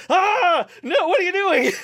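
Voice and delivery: high, loud mock scream of protest; imitating startled Amelia. Co-host imitates Amelia recoiling at the calisthenics bounce, mock shriek. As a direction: shriek it in mock alarm, breaking toward laughter. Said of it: "Amelia goes".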